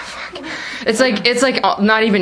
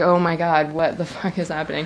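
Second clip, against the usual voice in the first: stunned; 4 semitones lower, 6 dB quieter than usual. Retold or imitated. Cop wants to see rifle.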